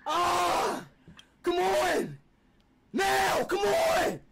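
high, hammed-up wail of agony; fake dying screams. Prank caller pretending to die, fake agonized screaming. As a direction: scream as if badly hurt, overdone.

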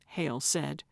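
plain reading voice

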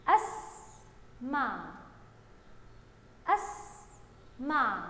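أسمع very slowly in two parts, أس then مع; slow syllabic reading. Shown as a demonstration. Teacher reading along with young pupils, slow and syllable by syllable. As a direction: draw out أسمع, pausing after أس. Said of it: "يلا مع بعض كده نقرأ".

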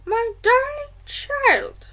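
high, drawn-out, amazed grandmother; slowly. A narrator's reading. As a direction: stretch each word in wonder. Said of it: "she said slowly".

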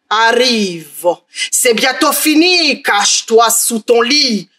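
ogre creeping up the stairs, slow and drawn out; slow menacing ogre. Acted. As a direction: drag each word, sinister and patient.

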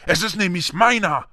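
put-on high, indignant boy's protest; furious. A narrator's reading. Boy saying the letter is his, high indignant anger. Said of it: "sagte Harry wütend".

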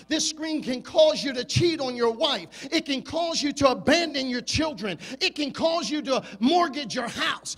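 building to a roaring shout; full-force preaching. Acted.

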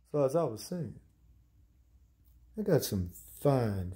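low, soft, rambling host voice; low-voiced cooking host. Cooking host promising viewers fine recipes, low and rambling. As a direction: introduce the food low and quiet.

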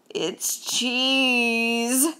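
long drawn-out, delighted cheese; excited cry. A narrator's reading. Hungry Mouse finds bait in the attic, excited cry. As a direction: linger greedily on the food word. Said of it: "cried Mouse".

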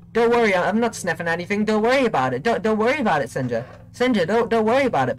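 high, hurried hammed-up denial; mock-defensive. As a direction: insist with playful panic that it's nothing. Comic denial of sniffing the listener.

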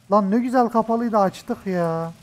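ordinary talk, nothing marked

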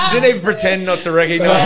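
high, loud laughing words; crying with laughter. Real, not acted. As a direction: push through big laughter. Laughing hard mid story.

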